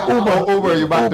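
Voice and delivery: laughing through the words; giggling while saying it. Real, not acted. Joking about Uber, laughing.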